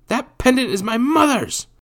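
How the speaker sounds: put-on very high, loud boy's protest; insistent boy. A narrator's reading. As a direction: insist on it at the top of your range. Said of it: "Jacques insisted".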